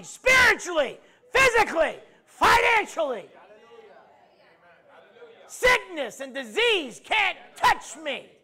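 loud, high, slow declarations shouted out; preaching at full force. Acted. Preacher leading the congregation, full-force preaching. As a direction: proclaim each line like a battle cry.

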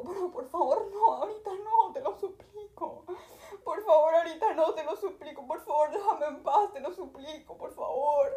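high, laughing, half-crying begging; laughing plea. Retold or imitated. Traveller begs the teasing family to leave her alone, high, laughing and half-crying. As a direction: beg them to stop through laughter.

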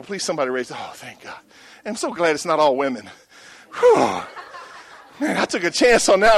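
ordinary talk, nothing marked

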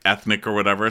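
ordinary delivery

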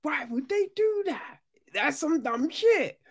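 very high, squeaky disbelief; high-pitched baffled dismissal; incredulous mockery. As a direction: scoff in a comic falsetto. Mocking the plot as senseless.

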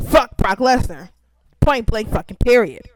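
loud ritual rant at the end of the intro; emphatic curse. Acted. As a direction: spit it out like a ritual catchphrase. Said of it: "my weekly fuck Brock Lesnar".

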